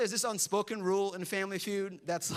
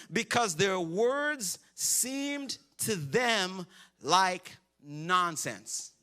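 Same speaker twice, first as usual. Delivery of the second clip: slow emphatic reading; much slower than usual. Acted. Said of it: "slow emphatic reading of verse".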